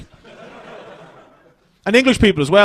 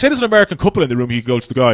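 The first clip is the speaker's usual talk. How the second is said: ordinary delivery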